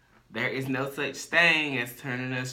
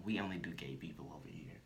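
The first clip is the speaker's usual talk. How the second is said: much softer, dropping to a whisper; hushed aside; 5 semitones lower, 12 dB quieter than usual. Acted. Telling what they say to straight guys, hushed aside. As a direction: let it sink into a near whisper.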